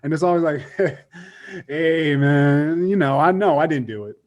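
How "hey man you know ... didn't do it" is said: casual, stalling answer, badly faked innocence; weird energy. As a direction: imitate a man hedging an unconvincing denial. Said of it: "this weird energy".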